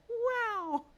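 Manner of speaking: very high, loud exclamation; amazed wow. Real, not acted. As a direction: let out a piercing wow. Reacting to a meme.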